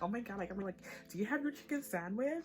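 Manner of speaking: mock American. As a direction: lightly mimic an American asking about Popeyes. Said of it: "I feel, like, proper American".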